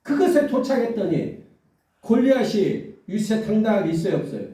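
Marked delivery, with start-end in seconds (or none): none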